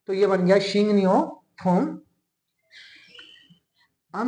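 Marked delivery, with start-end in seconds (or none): 0.6-1.9 शिंग नियो थोम read out carefully as the final form; Korean pronunciation. Shown as a demonstration. Teacher to students, giving the final Korean pronunciation. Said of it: "तो दो rule लग रहे हैं".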